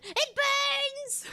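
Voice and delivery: high, loud cartoon cry of pain; mock agony. Crawling in pain in the Invader Zim art style, mock wail. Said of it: "Like I'm crawling in excruciating pain".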